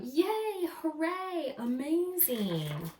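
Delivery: normal talking voice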